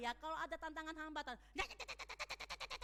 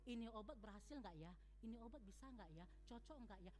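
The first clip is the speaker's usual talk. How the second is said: quick, much softer, lower worried self-questioning; doubtful muttering; 7 semitones lower, 13 dB quieter, faster than usual. Retold or imitated. A medic doubting the medicine before injecting, soft fretful muttering. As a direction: fret under your breath about the medicine.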